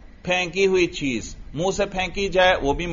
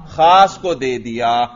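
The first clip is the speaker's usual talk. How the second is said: slow, louder, stress on خاص; emphatic answer; 5 semitones lower, 4 dB louder, slower than usual. Shown as a demonstration. Teacher answering his own question to drill students, slow emphatic خاص. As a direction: lean hard on خاص and drawl the answer.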